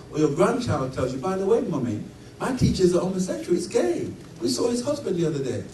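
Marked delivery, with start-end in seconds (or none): 1.2-5.6 put-on higher, chirpy child telling mummy news; a hint of a cheerful little child; child's voice. Grandchild casually telling mummy about the teacher, lightly voiced child.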